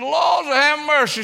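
high, loud exclamation; retold exclaiming lady. Church lady poking her head in, loud high exclamation. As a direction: cry it out as the lady at the door. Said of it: "She said".

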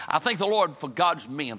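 ordinary talk

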